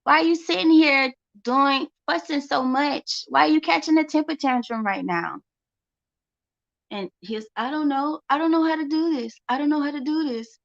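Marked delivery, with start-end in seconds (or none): 0.0-5.4 higher and louder, fed-up scolding; mock scolding a fussing son; exasperated mom. Mother scolding her son's tantrum, exasperated and higher. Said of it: "I'm just like". 7.6-10.6 put-on higher, fast, whining child; imitating a frustrated kid's whine; whiny son. Son whining to mom about homework, higher and fast. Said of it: "he would whine".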